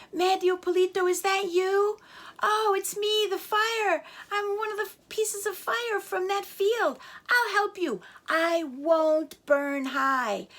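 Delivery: high, kindly little flame; a gentle flame promising to stay small; friendly fire voice. Acted.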